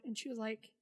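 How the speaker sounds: ordinary delivery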